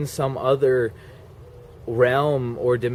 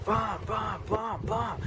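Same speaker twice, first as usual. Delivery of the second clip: running beat sound; 8 semitones higher, 10 dB quieter than usual. Retold or imitated. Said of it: "I was just like".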